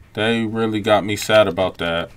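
ordinary talk, nothing marked

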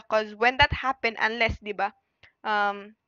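ordinary delivery

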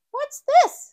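put-on high, loud surprised little girl; Peppa curious. A narrator's reading. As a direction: spot something and squeal. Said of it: "says Peppa".

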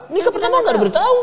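high, loud and quick through laughter; laughing. Real, not acted. Speaker tells interviewer about the uninvited wedding, laughing. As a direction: blurt it out laughing. Laughs over not being told.

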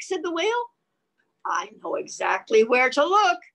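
put-on lively read-aloud voice for children; reading the book to little listeners; story narration. A narrator's reading. Parent reading a picture book to children, lively read-aloud voice.

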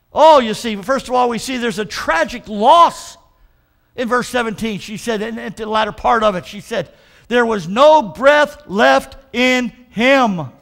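loud, slow, drawn-out preaching; full-force preaching. Acted. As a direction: preach it out to the congregation.

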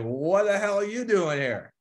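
high, drawn-out mock disbelief; incredulous retold outburst. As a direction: stretch it out high, acting out your astonishment. Confronts girlfriend found in divorce crowd.

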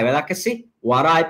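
usual speaking voice

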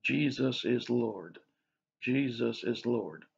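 slow, soft, mantra-like repetition. Shown as a demonstration. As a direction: repeat it slowly like a calming mantra. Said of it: "I just repeat to myself".

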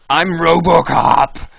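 lightly put-on hero boast, slow; Robocop voice. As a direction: announce yourself as Robocop. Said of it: "you can't pull that voice up".

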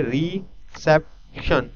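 Reception said slowly, syllable by syllable; slow teacher syllable count; slow syllable demo. Shown as a demonstration. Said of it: "ये तीन आवाज़ें".

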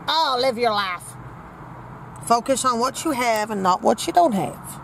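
usual speaking voice

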